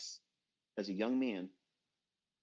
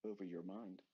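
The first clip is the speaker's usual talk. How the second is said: over your mind trailed off much softer; dropped to near silence; 3 semitones lower, 12 dB quieter than usual. Real, not acted. Telling traders what money does to them, hushed. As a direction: let over your mind fall away to a hush. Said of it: "That's all it has, over your mind".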